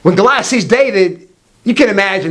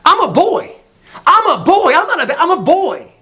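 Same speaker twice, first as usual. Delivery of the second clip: put-on high, indignant little-boy voice; mock toddler protest, high and emphatic; flipping-out toddler; 9 semitones higher than usual. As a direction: imitate an offended two-year-old. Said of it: "He flips out".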